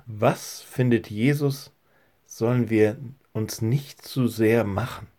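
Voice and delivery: ordinary delivery, nothing marked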